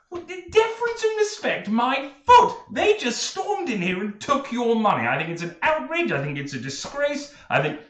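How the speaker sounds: high, loud indignant tirade; annoyed Tyndall. Acted. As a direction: splutter with outrage. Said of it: "he's clearly annoyed by this".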